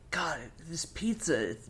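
whispered aside; whispering. Real, not acted. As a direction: breathe it without voice. Aside while watching.

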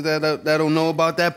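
usual speaking voice

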